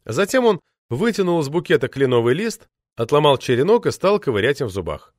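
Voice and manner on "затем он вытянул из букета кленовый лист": plain reading voice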